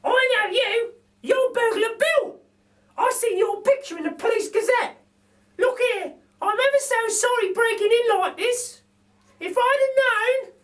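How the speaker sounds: a cheeky cockney burglar lady; Burglar Betty. A narrator's reading. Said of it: "says Burglar Betty".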